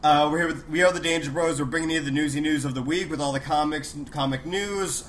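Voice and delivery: usual speaking voice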